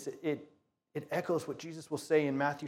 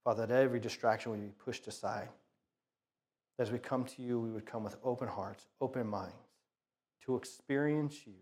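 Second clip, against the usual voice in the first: soft, hushed opening prayer; 4 semitones lower, 4 dB quieter than usual. Acted. Pastor praying softly before the sermon, hushed prayer. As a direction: pray quietly over the congregation.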